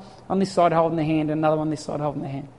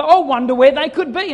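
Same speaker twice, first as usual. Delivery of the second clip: mock puzzled sing-song, louder; playful seeking voice; 8 semitones higher, 7 dB louder than usual. Dad calling out to hiding kids, playful mock puzzlement. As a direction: ham up the searching for the kids. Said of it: "I sort of said, really".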